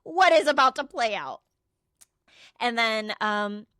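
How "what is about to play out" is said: loud, higher, panicked question; mock panic. Friend left alone before the showdown, mock panic. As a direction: blurt it out as if panicking.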